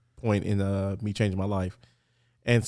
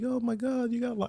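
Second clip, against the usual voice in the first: much higher, mock alarmed mother voice; worried mom; 13 semitones higher, 6 dB quieter than usual. Son retelling mom's alarm at his case, high mock panic. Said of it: "'cause she was like".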